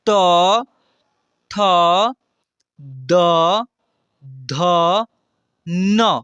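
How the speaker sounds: each letter said loud and slow with pauses; letter-by-letter recitation. Shown as a demonstration. Teacher reciting consonants slowly and loudly for students. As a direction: call out each letter clearly for the class to repeat. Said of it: "जैसे उच्चारण कर रहा हूँ मैं".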